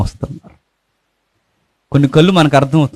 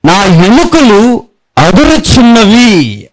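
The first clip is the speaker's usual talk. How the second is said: very slow, higher, trembling-feel reading; drawn-out verse; 5 semitones higher, 4 dB louder, much slower than usual. Acted. Preacher quoting the verse on trembling bones, very slow. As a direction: stretch every word of the verse. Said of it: "ఒక మాట ఉంది చూడండి".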